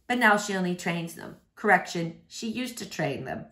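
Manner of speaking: ordinary delivery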